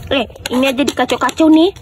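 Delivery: ordinary talk, nothing marked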